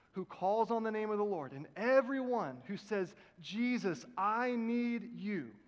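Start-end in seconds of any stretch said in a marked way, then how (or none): 3.4-5.5 slow, stretched, aching plea; pleading cry. Pretend. Believer calling on Jesus in a slow aching plea. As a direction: let it ache, calling for help.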